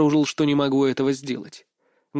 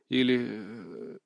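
slow, softer, lower trail-off; faltering hesitation; 5 semitones lower, 9 dB quieter, much slower than usual. A narrator's reading. Man invites the woman to eat, faltering mid-sentence. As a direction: stall on a drawn-out filler as the thought is lost. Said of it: "Я запнулся".

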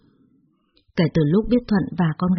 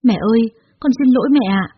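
tearful pleading; 4 semitones higher, 4 dB louder than usual. Acted. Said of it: "Nó khóc nức lên và van xin tôi".

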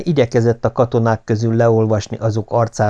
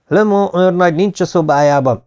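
high, raised announcement shout; calling out; 9 semitones higher, 4 dB louder than usual. A narrator's reading. Corporal calling to comrades about the missing major, shouting. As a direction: cry out the news to your comrades. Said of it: "kiáltotta oda társainak".

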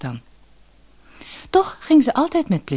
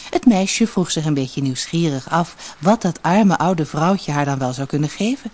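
ordinary delivery, nothing marked

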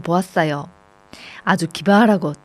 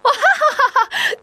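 put-on high children's laughter bursting out. A narrator's reading. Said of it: "큰 소리로 웃음을 터뜨렸죠". (아이들이 터뜨리는 웃음소리)

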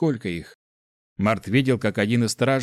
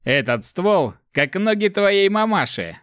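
high, drawn-out taunt; mocking jeer; 9 semitones higher, 4 dB louder, slower than usual. A narrator's reading. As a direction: jeer it out high and slow at a comrade. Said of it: "и хрипло усмехнулся".

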